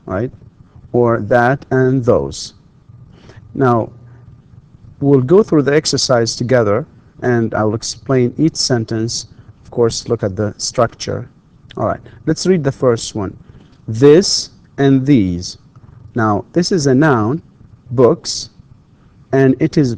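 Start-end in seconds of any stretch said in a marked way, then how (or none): 0.9-2.5 slow it down so students catch every word; slow, deliberate reading. Shown as a demonstration. Teacher to students reading the choices, slow and clear. 13.9-15.6 slow, deliberate reading. Shown as a demonstration. Teacher to class naming the two options, slowly.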